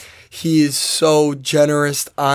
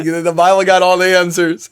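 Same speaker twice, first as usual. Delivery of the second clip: louder mock scepticism, breaking into laughter; amused mockery; 3 semitones higher, 5 dB louder than usual. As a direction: scoff and laugh at the know-it-alls. Mocking her past skepticism, laughing.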